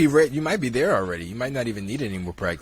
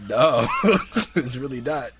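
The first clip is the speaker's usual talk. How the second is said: laughing; 3 semitones higher than usual. Real, not acted. Laughing while denying.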